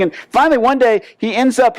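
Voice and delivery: ordinary delivery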